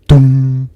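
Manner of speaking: loud, booming "dong" held on one note; clock chime. A narrator's reading. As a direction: imitate a striking clock, ringing out the hum. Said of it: "called the clock".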